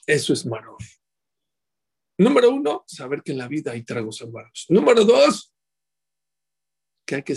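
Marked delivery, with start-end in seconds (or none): 2.2-2.8 number one called out high and loud; emphatic count. Real, not acted. Rabbi calling out his first point, high and loud. 4.7-5.5 number one called out high and loud; emphatic count. Real, not acted. Rabbi calling out his first point, high and loud.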